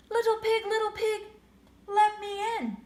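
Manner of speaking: high, loud wolf calling at the door. Acted. Wolf at the pig's door, calling loud and high. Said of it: "said the wolf".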